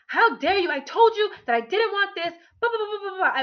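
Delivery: high, loud angry outburst trailing into blah blah; furious retort. Retold or imitated. To the partner who ignored her no, angry retold outburst. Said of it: "I was infuriated".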